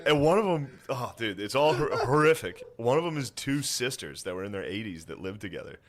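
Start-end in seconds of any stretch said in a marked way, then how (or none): none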